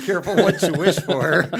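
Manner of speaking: real belly laugh through the words, high and loud. Real, not acted. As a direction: crack up while teasing. Joking after the guest's "boom.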